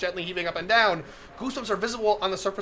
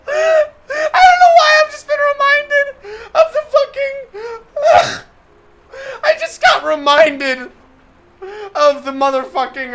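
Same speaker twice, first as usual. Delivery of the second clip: loud, high squeal through laughter; slow, shouted words broken by real laughter; hysterical laughing; 11 dB louder, slower than usual. Real, not acted. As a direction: force the words out while cracking up. Cracks up at a remembered meme.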